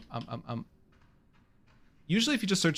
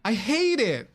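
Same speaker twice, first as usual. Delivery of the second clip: higher-pitched hammy complaint; exasperated; 4 semitones higher, slower than usual. Real, not acted. Streamer losing the pronunciation argument to Google, exasperated. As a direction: whine in protest at the recording. Protests at the repeating recording.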